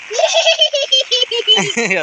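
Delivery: put-on high prompting laugh for the child; laugh to show him. Shown as a demonstration. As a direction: laugh out so he copies it. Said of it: "आणि हस आता". (मुलाला हसवण्यासाठी उंच आवाजात हसणे)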